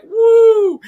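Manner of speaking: loud high whoop of glee; shrieking woo; excited whoop. Retold or imitated. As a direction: whoop it out like a hyped fan. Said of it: "we're coming in here and, like".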